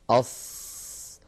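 أص hissed slowly and unvoiced, the sound trapped between the tongue and the palate; slow unvoiced أص with the tongue pressed to the palate; pressed sibilant ṣād. Shown as a demonstration. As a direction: squeeze out أص as a long, tight, whistling hiss. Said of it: "أص، ينحصر وينضغط".

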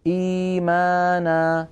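إيمانا recited with the madd held long and slow; drawn-out madd recitation. Shown as a demonstration. Teacher reciting Quran example, stretching the madd. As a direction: chant إيمانا with two-beat madd stretched. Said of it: "كقوله تعالى إيمانا".

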